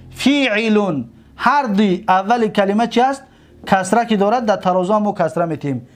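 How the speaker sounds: ordinary delivery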